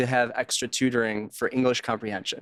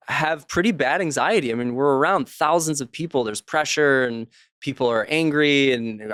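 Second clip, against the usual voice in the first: normal talking voice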